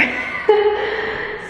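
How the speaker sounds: high, airy giggle; self-conscious little laugh after getting emotional. Real, not acted. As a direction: laugh it off lightly.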